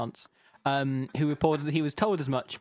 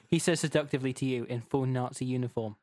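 ordinary talk, nothing marked

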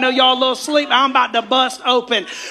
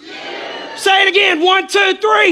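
loud, high rallying count; preacher counting the crowd in at full voice; hype countdown; 7 semitones higher, 3 dB louder, slower than usual. Real, not acted. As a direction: pump the sleepy crowd up. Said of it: "I'm about to wake you".

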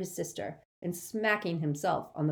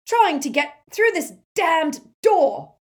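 furious bellow, much higher and louder, slow; shouted; 13 semitones higher, 12 dB louder, slower than usual. A narrator's reading. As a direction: roar it at the door in rage. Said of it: "shouted Amycus".

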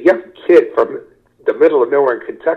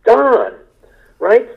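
higher, pushy friendly correction, a light comic growl; Don Rickles mock gruffness; 9 semitones higher than usual. Retold star correcting a jogging fan, mock-gruff and higher. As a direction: play the famous comic insisting on first names. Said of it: "And he goes".

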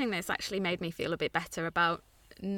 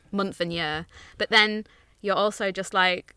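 usual speaking voice

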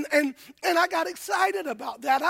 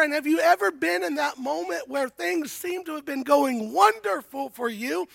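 usual speaking voice

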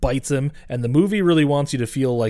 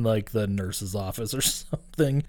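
ordinary talk, nothing marked

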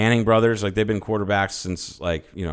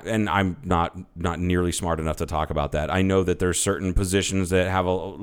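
usual speaking voice

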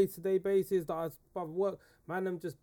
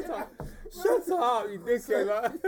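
laughing; 4 semitones higher, 5 dB louder than usual. Real, not acted. Teased by friends, laughing.

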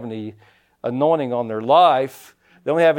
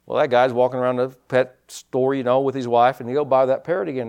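usual speaking voice

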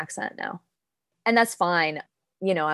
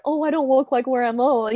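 higher, whiny put-upon sigh of a victim; mock self-pity; 8 semitones higher, 3 dB louder than usual. Mocking her own victim voice while talking about her heritage. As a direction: play the poor little victim, half joking. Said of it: "in this kind of victim seat of".

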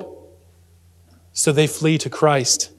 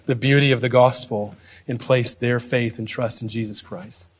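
quiet, low-key spoken prayer; 3 semitones lower, 4 dB quieter than usual. Real, not acted. Pastor praying to God for the congregation, quiet prayer. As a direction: pray it softly, pausing between phrases.

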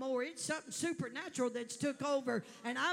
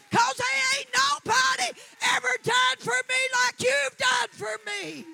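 louder, higher testimony cry; rising praise shout; preaching at full force; 4 semitones higher, 8 dB louder than usual. Real, not acted. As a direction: shout her thanks to God.

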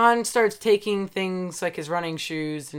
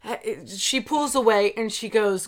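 ordinary talk

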